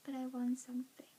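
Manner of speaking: soft whisper to herself; whispered consolation. Retold or imitated. Girl left off announcements, whispered self-comfort. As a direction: murmur it to cheer yourself. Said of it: "I was just like".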